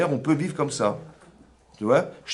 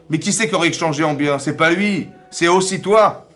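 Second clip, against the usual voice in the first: emphatic preaching; 5 semitones higher, 7 dB louder than usual. Real, not acted. Presses the point in a sermon.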